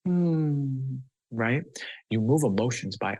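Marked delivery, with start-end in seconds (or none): 0.0-1.0 a mild gentle hum. Shown as a demonstration. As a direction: hum it gently, barely there. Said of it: "just gently breathing like".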